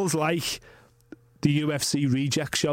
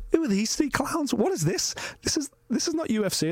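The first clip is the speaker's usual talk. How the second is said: imitating wife; 10 semitones higher than usual. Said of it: "burst out laughing, pissing herself, going".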